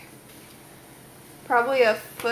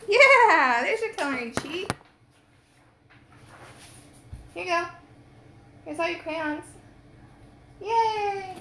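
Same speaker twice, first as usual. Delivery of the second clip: pet talk; 7 semitones higher than usual. Real, not acted. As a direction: coo at the animal. Giving the pet crayons.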